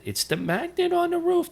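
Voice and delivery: imitating an old-timer in a very high, squeaky register; Joe Selly's high-pitched voice. Old firefighter talks about the roof magnet, high-pitched impression. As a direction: push it far up high, mimicking a colleague. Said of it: "That's the way he talked. He had a high-pitched voice".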